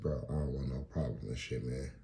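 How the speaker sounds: the scared visitor pleads with the mugger, low and soft; timid plea. Retold or imitated. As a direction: shrink away from the threat. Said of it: "I said".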